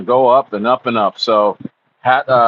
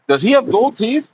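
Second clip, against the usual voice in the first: much higher, silly voice; playful pitched-up voice; mock high question; 11 semitones higher than usual. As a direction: squeak the question comically.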